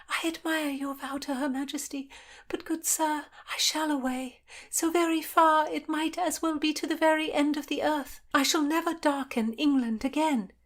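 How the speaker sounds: put-on high-pitched girl pleading her exile; young noblewoman announcing her flight; young woman's voice.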